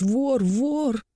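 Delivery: high-pitched cry of alarm without real volume; mock shouting. A narrator's reading. Monk raising the alarm on himself, mock shouting. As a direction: call out the alarm, pitched well up. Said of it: "А потом стал кричать".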